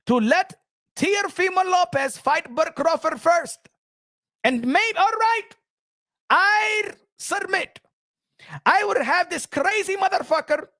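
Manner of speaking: put-on high-pitched, heavy-accented boss ranting; the Turk impression. The Turk scolding Canelo over the Crawford fight, high and ranting. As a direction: lecture Canelo like the man who pays him, rising to shouts. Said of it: "You understand the Turk?".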